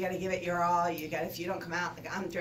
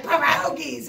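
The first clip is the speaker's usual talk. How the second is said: higher and much louder, playfully imitating the players; mock Pittsburgh girls; 8 semitones higher, 12 dB louder, slower than usual. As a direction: mimic the girls answering eagerly. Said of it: "all the Pittsburgh girls are like".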